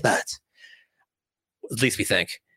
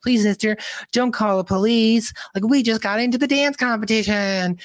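put-on pleading girls; 6 semitones higher than usual. Girls beg the guard.

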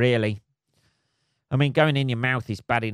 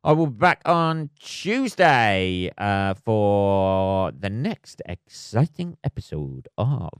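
mock announcer; 3 semitones lower, much slower than usual. Host teasing next episode, slow hammed-up announcer voice. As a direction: stretch it out like a hammed-up promo. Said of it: "I don't know why I'm talking like that".

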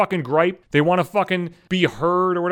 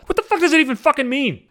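higher, louder, ranting at the rude patient; angry outburst; 9 semitones higher, 5 dB louder than usual. Real, not acted. Ranting at the patient who kept him waiting, angry shout. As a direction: let the outrage burst out at them.

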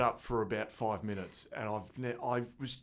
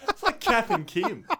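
laughing; 8 dB louder than usual. Real, not acted.

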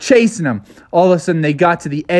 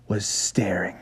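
hushed storyteller whisper; bedtime-story narrator whispering the scary part; suspense whisper; 5 semitones lower, 10 dB quieter than usual. A narrator's reading. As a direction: drop to a whisper to build suspense. Suspense as gator stares.

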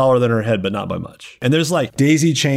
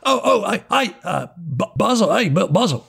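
lightly voiced, higher-pitched stammering hail; figure calling out; 8 semitones higher than usual. Game master's figure hails Basil, stammering and higher. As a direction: stumble over the call, pitched up.

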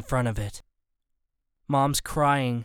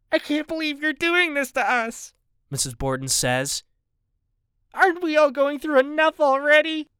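higher, distraught woman's voice; upset wife; 12 semitones higher than usual. A narrator's reading. Missus Borden protests to the gunman, distraught. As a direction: protest through rising panic.

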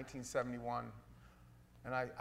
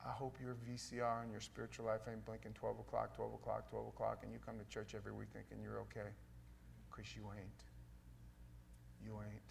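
low, soft, sober admonition; quiet warning; 6 semitones lower, 13 dB quieter than usual. Real, not acted. Preacher warning churchgoers who think they're okay, quietly grave. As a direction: drop right down and let the warning land. Sudden hushed warning to congregation.

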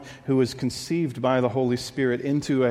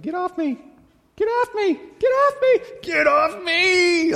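put-on a child's squeal rising into a crying scream; kids fighting, growing desperate; 6 dB louder than usual. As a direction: shriek it higher and more desperate each time. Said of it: "it becomes more desperate as it goes".

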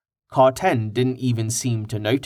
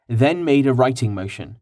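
usual speaking voice

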